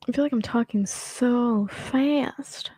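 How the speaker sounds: ordinary talk, nothing marked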